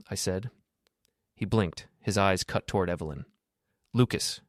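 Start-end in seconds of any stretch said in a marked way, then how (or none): none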